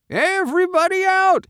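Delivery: loud, very high call; farmer calling out. A narrator's reading. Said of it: "he called".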